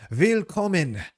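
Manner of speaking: put-on high, loud Germanic-accented welcome; imposing matriarch. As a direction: call out grandly to the newcomers. Voices imposing village matriarch.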